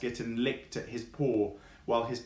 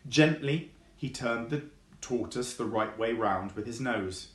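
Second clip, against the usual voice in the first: plain reading voice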